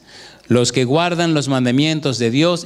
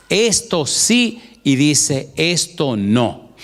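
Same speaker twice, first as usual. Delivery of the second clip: slow contrast; much slower than usual. Acted. Preacher contrasting yes and no.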